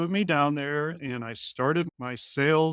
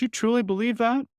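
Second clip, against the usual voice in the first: doubtful lab manager; 8 semitones higher, 4 dB louder than usual. Retold or imitated. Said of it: "he goes".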